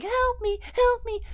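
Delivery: high whimpering help me; mock pleading. Clerk begs the robber for help, a high mock plea. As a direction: plead like a clerk curled up on the floor. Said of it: "in a fetal position".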